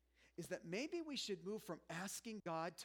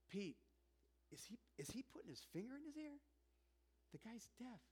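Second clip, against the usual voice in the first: softer, a hushed aside to a friend; mock whisper between onlookers; 6 semitones higher, 7 dB quieter than usual. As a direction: whisper it so Jesus won't hear. Disciples whispering while watching Jesus.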